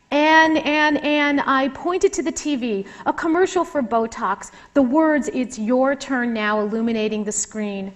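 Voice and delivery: expressive reading of her own memoir; author reading aloud. Acted. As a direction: read it like a writer sharing her chapter. Author reads her chapter aloud.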